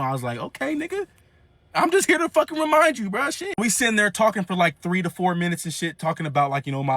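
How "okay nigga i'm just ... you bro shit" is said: high, exasperated reminder; a put-upon grandson rolling his eyes. Retold or imitated.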